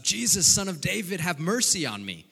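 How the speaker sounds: loud, high desperate plea; the blind beggar cries out to Jesus over the crowd, loud and pleading; crying out. Acted. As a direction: call out to him across the crowd. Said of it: "He cries out".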